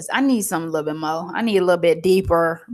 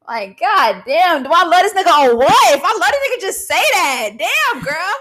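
excited outburst; 9 semitones higher, 6 dB louder than usual. Retold or imitated. Mock-scolding herself in the bedroom.

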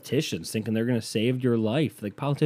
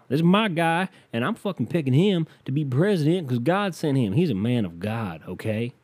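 pitched-up Southern political fan; Southern supporter; 4 semitones higher than usual. Acted. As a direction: proclaim it as a hammed-up Southern believer. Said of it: "if I coulda just done this fucking voice".

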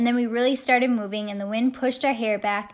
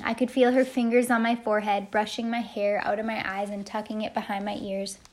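usual speaking voice

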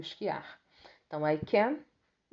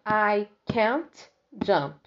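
slow and louder, "can't" stressed; slow model sentence; 3 semitones higher, 8 dB louder, much slower than usual. Shown as a demonstration. Teacher reading the elephant's line to students, slow and loud. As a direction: project it slowly to the class. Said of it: "E do lado".

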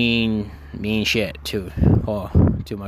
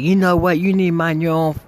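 high, louder imitation of an angry mom; mock someone telling you off, pitched up; retold angry outburst; 7 semitones higher, 4 dB louder than usual. Mom turns on the storyteller, retold angry outburst.

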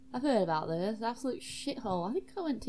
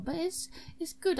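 ordinary talk, nothing marked

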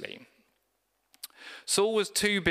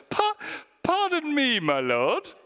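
much higher hammed-up posh British pardon; exaggerated British politeness; 12 semitones higher, 3 dB louder than usual. Preacher repeats Gideon's reply with a hammed-up British accent. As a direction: ham up a prim British gent. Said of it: "the most British Bible translation".

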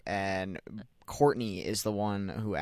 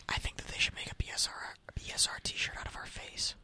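soft whispered ASMR voice; 6 dB quieter than usual. Pretend. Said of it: "A S M R style".

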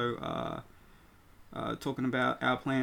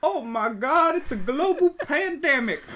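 much higher, loud mock announcement through laughter; excited announcer; 13 semitones higher, 6 dB louder than usual. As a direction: announce the segment title with big excitement. Announcing the new segment.

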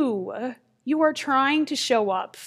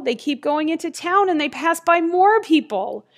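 ordinary delivery

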